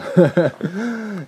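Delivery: high, loud giggle; high laugh. Real, not acted. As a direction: giggle at the joke. Laughs at Family Guy quote.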